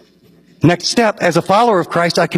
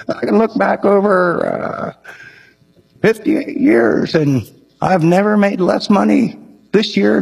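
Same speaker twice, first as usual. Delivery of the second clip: put-on slower, higher quavering old man; 4 semitones higher than usual. Old architect telling the pastor about his giving, slow old man voice. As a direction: speak slowly like an elderly gentleman. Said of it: "he was really old".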